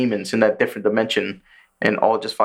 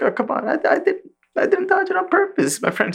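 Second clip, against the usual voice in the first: much higher squeaky whine; high-pitched mocking demon. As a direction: whine like a fake-innocent demon. Mimicking upper rank two again.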